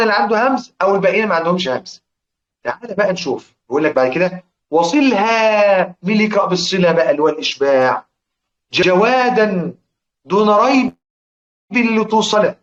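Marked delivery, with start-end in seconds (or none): 4.7-5.9 one word chanted very slowly; verse recitation. Shown as a demonstration. 8.7-12.5 slow chanted verse, a little higher; verse recitation. Shown as a demonstration.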